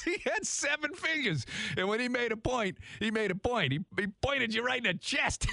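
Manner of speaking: quoting the story through barely held-back laughter; laughing while retelling. Real, not acted. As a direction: repeat his words while cracking up. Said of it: "I'm trying not to laugh".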